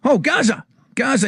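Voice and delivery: high-pitched mock call for attention; retold shout. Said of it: "So he shouts to Gascoigne".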